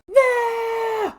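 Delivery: very high, loud cry; shout. A narrator's reading. Mikey shouts back at Mabel. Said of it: "Mikey shouted in turn".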